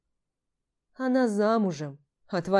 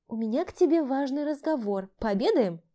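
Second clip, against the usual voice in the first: coaxing woman; 6 semitones higher than usual. Acted. Stepmother coaxing.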